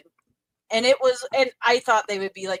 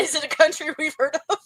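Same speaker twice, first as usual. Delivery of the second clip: laughing while asking; chuckling through the question; 7 semitones higher than usual. Real, not acted.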